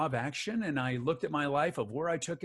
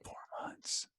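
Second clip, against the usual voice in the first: whisper; 12 dB quieter than usual. Real, not acted. Whispered answer about the long delay.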